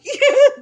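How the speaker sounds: high, loud burst of laughter; real laugh. Real, not acted. Storyteller laughing at her fiance's threat, real laugh. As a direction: burst out laughing mid-quote.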